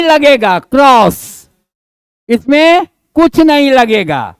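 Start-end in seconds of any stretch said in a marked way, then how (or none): none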